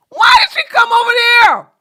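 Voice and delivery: high, loud, fast disbelieving cry; shocked outburst. Real, not acted. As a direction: yell it out in sheer disbelief. Shocked recognizing a podcast guest.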